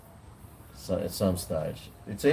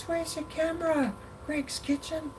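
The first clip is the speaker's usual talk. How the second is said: much higher, squeaky eager stranger voice; imitating a fan; 3 dB quieter than usual. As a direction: mimic the excited fan, way up high. Retelling a fan in the park.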